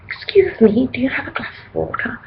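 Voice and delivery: tiny, whispered polite request; really polite. Retold or imitated. Gig-goer asking the bouncers for water, meek polite whisper. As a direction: ask very politely in a hushed whisper. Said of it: "like, really politely".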